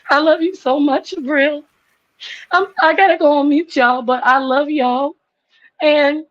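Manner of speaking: cracking up with love for her friend; real tearful emotion. Real, not acted. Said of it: "I'm about to cry again".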